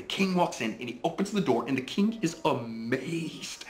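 high, amazed storyteller; animated story narration. A narrator's reading. Dad voices the astonished king at the door, high animated storytelling.

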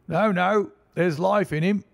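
high, loud shout from the crowd. A narrator's reading. A bystander shouts back at the crowd, insistent. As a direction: shout it over the others. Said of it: "shouted another".

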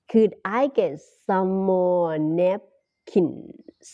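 slow, careful teacher delivery; slow clear classroom model; slow model phrase. Shown as a demonstration. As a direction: say it slowly for learners to repeat. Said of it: "teacher models restaurant phrase slowly".

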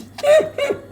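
high laugh. Real, not acted. After her own song, a high squealing laugh.

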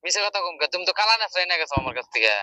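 normal talking voice

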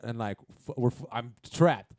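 normal talking voice

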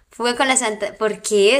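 ordinary talk, nothing marked

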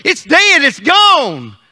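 loud, high dramatic declaration; forceful pulpit proclamation; preacher's emphatic cry. Acted. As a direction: hammer each short phrase home to the congregation. Preacher acts out hopeless messengers.

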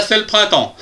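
usual speaking voice

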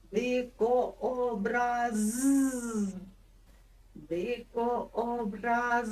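Дикообраз very slow, sound by sound; slow spelling-out. Shown as a demonstration. Teacher stretching Дикообраз so children catch the final з. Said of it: "Ловимо".

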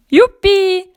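tiz, yüksek sesli sevinç; coşkuyla, tiz sesle kutla; sevinç nidası. Acted. Sevinç çığlığı. (neşeli sevinç çığlığı)

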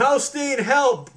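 loud high call for help; frightened girl yelling for her friend; panicked cry for help. A narrator's reading. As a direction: shout for help across the water. Ellen panicking as the horse wades.

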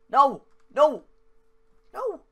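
two high, loud cries of "no"; panicked shouting. Real, not acted. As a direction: yell helplessly at the falling plane. Plane coming down to crash.

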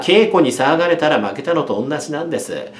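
ordinary delivery

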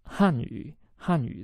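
"Hanyu" with Han falling; teacher showing fourth-tone Han, going down. Shown as a demonstration. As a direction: drop Han downward in "Hanyu". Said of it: "that's fourth tone, going down".

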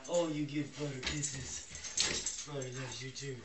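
low, soft doting coo; pet talk. Real, not acted. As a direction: croon lovingly to the dog. Cooing pet talk to the dogs.

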